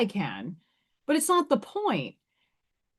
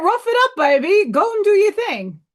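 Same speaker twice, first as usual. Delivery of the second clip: higher and louder, a jeering imitation of the men; mocking tough talk; 8 semitones higher, 6 dB louder than usual. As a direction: throw it back at them in a mocking voice. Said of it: "They mock me".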